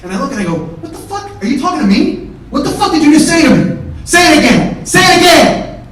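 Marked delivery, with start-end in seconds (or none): 0.8-1.3 high, held-back disbelief; stunned and high-voiced, before the blow-up; incredulous retort. 1.4-5.8 high, loud challenge building to a shout; enraged, escalating shout; furious confrontation. Retold or imitated. Acted furious confrontation with driver.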